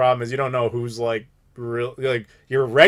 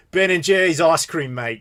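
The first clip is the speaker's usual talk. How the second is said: Australian accent; 2 semitones higher than usual. Shown as a demonstration. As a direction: say "Ben and Jerry's ice cream mate" the way an Australian would. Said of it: "when you say that with an Australian accent".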